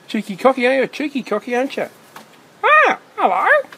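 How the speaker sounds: very high, loud sing-song calls to a cockatoo; bird-talk voice. Real, not acted. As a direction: call up to the parrot like talking to a pet bird. Calling to cockatoos in trees.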